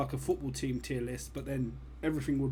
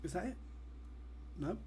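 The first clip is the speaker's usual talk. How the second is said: much softer, waiting for a sneeze; hushed, sneeze that won't come; about to sneeze; 3 semitones higher, 11 dB quieter, faster than usual. Real, not acted. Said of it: "Am I gonna sneeze again?".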